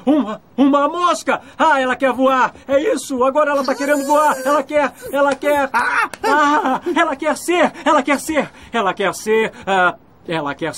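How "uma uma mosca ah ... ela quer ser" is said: higher, quick, eager bursts of guesses; excited guessing. Acted. Groom shouting guesses at the miming priest, excited. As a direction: blurt each guess as it clicks.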